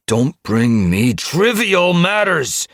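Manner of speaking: high, loud, drawn-out yell; furious leader's outburst. A narrator's reading. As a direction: shout the rebuke slowly and hard. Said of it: "Splashtail was furious".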